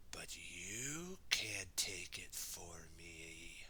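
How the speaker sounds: slow, low whisper, drawn-out; spooky low tone. Acted. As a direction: whisper low, stretching it temptingly. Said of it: "low, fun, uh, tone there".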